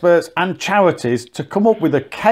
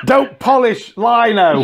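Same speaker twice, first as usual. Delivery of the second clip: higher, slow mock warning; public info film voice; 6 semitones higher, slower than usual. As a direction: deliver it like a stern safety notice. Mocking safety film warning.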